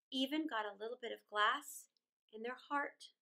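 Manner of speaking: plain reading voice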